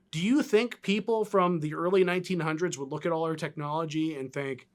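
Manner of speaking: normal talking voice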